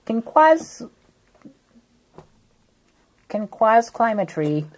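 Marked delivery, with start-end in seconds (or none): none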